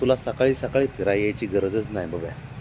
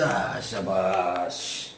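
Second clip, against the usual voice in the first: praising the dog; 3 semitones lower, 3 dB quieter, much slower than usual. Real, not acted. Praising dog after jump.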